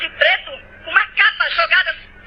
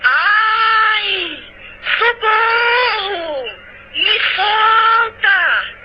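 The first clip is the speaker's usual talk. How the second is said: long drawn-out wailing cry, higher; imitating screams for help; 3 semitones higher, much slower than usual. Woman imitates the tormented crying for help from the pit, long wailing cries. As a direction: drag each word out in a mock agonised wail. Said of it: "gritando assim".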